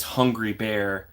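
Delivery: slow, stretched "Hungry Bear"; savouring the name; drawn out. Acted. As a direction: relish the name slowly. Reading a name from chat.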